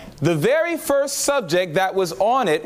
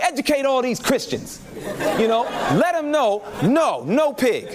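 gleeful laughing cheer; 7 semitones higher than usual. Retold or imitated. Gleeful approval of the sermon.

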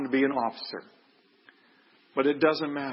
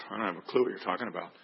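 quick, low, blank aside; blank incomprehension; 5 semitones lower, 4 dB quieter, much faster than usual. Retold or imitated. Officer asked what the lamb means, blankly clueless. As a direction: toss it off low and fast, clueless. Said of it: "had a blank look on his face".

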